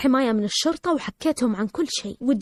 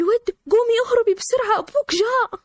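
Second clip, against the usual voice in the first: mother's high, urgent alarm; panicked warning; 11 semitones higher than usual. Retold or imitated. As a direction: urge her to run, pitched far up. Mother urgently warns her to flee.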